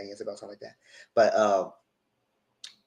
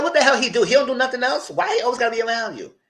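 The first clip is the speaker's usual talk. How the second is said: indignant quote; 10 semitones higher than usual. Retold or imitated. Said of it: "I said".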